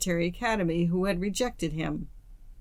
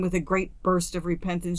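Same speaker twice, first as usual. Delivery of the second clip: ordinary delivery, nothing marked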